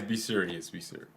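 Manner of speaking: usual speaking voice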